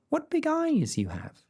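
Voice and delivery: put-on high, bright little girl answer. A narrator's reading. Girl answering the wolf, high and eager. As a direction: chirp it like a small girl.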